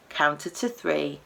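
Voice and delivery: ordinary delivery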